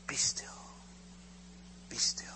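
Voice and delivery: whispered, with a long pause; soothing whisper. Retold or imitated. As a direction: hush someone gently into stillness. God soothes the embraced child.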